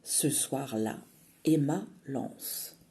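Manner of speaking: ordinary delivery, nothing marked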